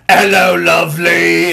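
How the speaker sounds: loud, drawn-out British greeting; British shark. Acted. Shark breaches the water beside a boat, loud British greeting. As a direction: call out in a hammed-up British accent. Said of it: "a light British angry accent".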